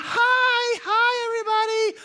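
high, loud hammed-up hello; cheery crowd greeting. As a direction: beam at the crowd again.

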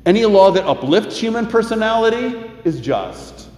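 rising pulpit voice, reading scripture with weight; measured preacher's cadence, lightly heightened; sermon preaching. Acted. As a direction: preach it to the congregation, lifting key lines. Preaches sermon, reads quotations.

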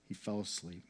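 soft, gentle close; hushed. A narrator's reading. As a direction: let the words drift off like falling asleep. Stephen's death, softly.

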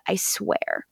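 whispered vow. Retold or imitated. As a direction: breathe the promise softly. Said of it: "He says".

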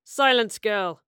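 loud order to be silent; commanding bark. A narrator's reading. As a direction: bark it over her protest. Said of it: "ordered Quintus".

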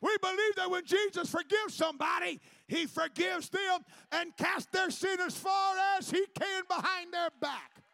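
loud, high, slowing shouted preaching; preaching at full force. Acted. Preacher proclaiming forgiveness to the church, shouting. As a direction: proclaim it, stretching the end.

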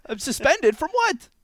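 high, indignant question; squawked objection; incredulous protest. Acted. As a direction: pitch it way up in disbelief. Told he is suspended.

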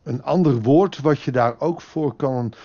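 ordinary talk

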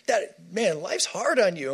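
lightly voiced friend going oh that's so hard; pitying friend. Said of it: "someone going".

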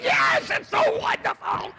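high, loud, slow fake gush; fake excitement. Preacher faking joy at another man's windfall, hammed-up. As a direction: overdo the joy so it rings false. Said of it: "I was like".

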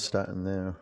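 "starting there" repeated softly, trailing off; soft echo. Shown as a demonstration. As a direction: let "there" fade out quietly. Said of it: "starting there (repeated)".

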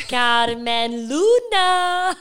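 loud, drawn-out Spanish flourish; Spanish accent. Pretend. Sister playing at speaking Spanish, hammed-up Spanish accent. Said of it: "I love speaking in an accent".

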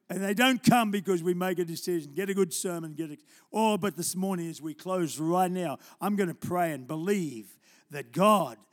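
loud, pressing preacher's appeal; altar call. Acted. Preacher closing the sermon with a loud appeal. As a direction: build the appeal to the room.

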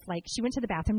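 ordinary delivery

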